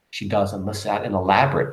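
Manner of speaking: ordinary talk, nothing marked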